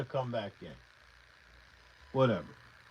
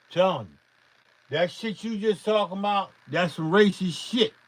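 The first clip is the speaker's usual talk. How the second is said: normal talking voice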